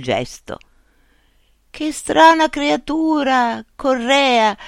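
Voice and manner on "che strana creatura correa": high, slow astonished voice; a blind man marvelling at a strange creature; Pedro marvelling. A narrator's reading. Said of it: "disse quello che si chiamava Pedro". (Pedro stupito, voce alta e lenta)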